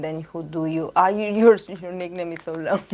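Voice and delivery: ordinary talk, nothing marked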